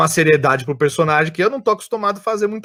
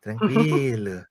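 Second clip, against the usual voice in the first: very slow, lower sloth drawl; Flash sloth voice; 3 semitones lower, 4 dB quieter, much slower than usual. Shown as a demonstration. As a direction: drag every word like a sloth. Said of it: "ele fala".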